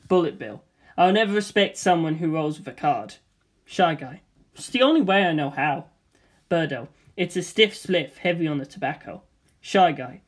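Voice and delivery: ordinary talk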